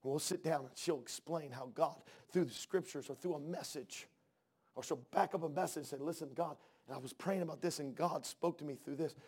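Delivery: soft, low, intimate storytelling; hushed testimony. Real, not acted. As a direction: share a tender memory, voice dropped well down. Intimate memory told to congregation.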